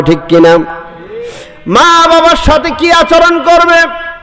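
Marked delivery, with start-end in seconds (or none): 1.7-4.1 high, ringing sermon cry; fiery preaching. Acted. Preacher calling to the congregation, fiery and high. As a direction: preach it out to the crowd at full force. Preacher rises to full force.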